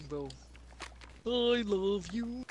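Barney. Pretend. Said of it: "I had the Barney accent".

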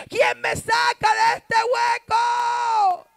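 high, slow shout, last word stretched; desperate wail. Acted. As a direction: bellow a drawn-out plea for rescue. Voicing humans' desperate cries. (grito desesperado, agudo y alargado)

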